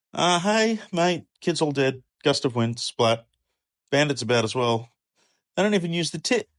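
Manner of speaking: high, hurried bad-news voice; a mate blurting out the worst; breathless messenger. Fourth messenger telling Job his kids are dead.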